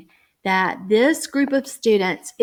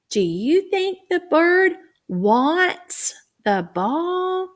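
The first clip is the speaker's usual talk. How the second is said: higher inviting question; teacher question; 9 semitones higher, slower than usual. Shown as a demonstration. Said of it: "Say the word wants".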